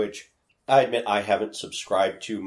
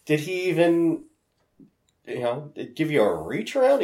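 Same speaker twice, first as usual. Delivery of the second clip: teasing; 9 semitones higher than usual. Retold or imitated. Teasing friend about the date.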